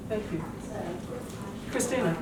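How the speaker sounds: ordinary talk, nothing marked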